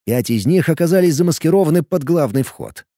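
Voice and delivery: plain reading voice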